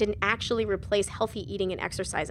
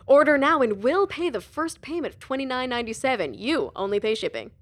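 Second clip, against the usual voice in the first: infomercial announcer; 3 semitones higher, 5 dB louder than usual. A narrator's reading. Infomercial announcer Mark speaking.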